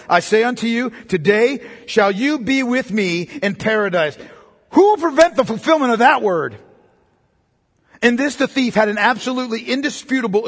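loud, high, ringing preaching; thundering sermon voice; royal proclamation preaching. Acted. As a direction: proclaim it like an imperial decree. Preaching at full force.

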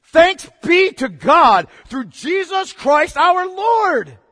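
loud, high, slow cry of thanks; triumphant praise. Acted. Preacher voicing Paul's thanksgiving, triumphant slow praise. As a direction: proclaim it like sudden relief.

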